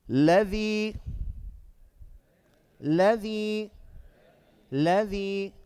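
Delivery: chanted word with a rising, held ending; measured tajweed drill for repetition; Quran recitation. Shown as a demonstration. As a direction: recite it evenly, stretching the last vowel. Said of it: "applying emphasis".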